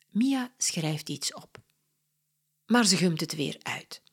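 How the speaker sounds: usual speaking voice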